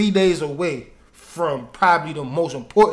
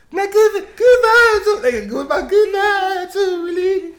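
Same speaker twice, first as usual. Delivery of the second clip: high, loud, laughing lady voice; mock it high with laughs; 5 dB louder than usual. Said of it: "That's the lady voice".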